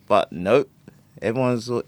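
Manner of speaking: ordinary talk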